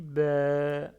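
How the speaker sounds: BA stretched long and loud, level; drawn-out vowel. Shown as a demonstration. Teacher reads second syllable, BA held long. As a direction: hold the vowel of BA long, "bèèè". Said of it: "c'est la même chose".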